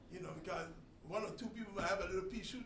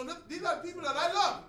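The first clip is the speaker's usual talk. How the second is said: loud, raised voice quoting God; God's proclamation; 8 semitones higher, 9 dB louder than usual. Retold or imitated. Preacher voices God to Balaam, loud proclamation. God quoted speaking to Balak.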